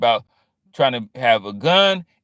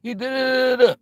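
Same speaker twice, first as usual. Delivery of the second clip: high hammed-up shouting reporter; mocking reporters; 11 semitones higher than usual. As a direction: badger him like a pushy press pack. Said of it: "They was".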